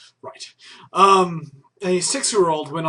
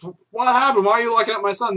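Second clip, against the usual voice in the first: upset parents; 5 semitones higher, 3 dB louder, faster than usual. Retold or imitated. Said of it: "his parents are like".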